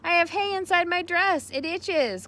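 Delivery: put-on high, loud girl's call. A narrator's reading. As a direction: call out across the barn. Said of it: "called Fern".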